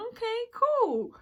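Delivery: high, loud, slow okay; surprised. Retold or imitated. Finding him awake, she reacts loudly. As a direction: draw it out in surprise.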